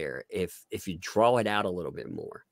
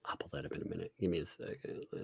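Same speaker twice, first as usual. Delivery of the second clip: very soft, half-whispered aside; hushed, absent-minded murmur; muttering to self; 3 semitones lower, 15 dB quieter, faster than usual. Real, not acted. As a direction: mumble under your breath while busy. Muttering to self while searching.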